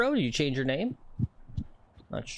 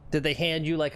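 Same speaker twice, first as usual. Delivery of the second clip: ordinary delivery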